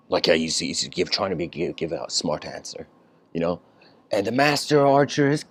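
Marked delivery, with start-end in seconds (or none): none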